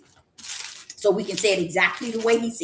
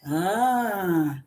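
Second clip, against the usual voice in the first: usual speaking voice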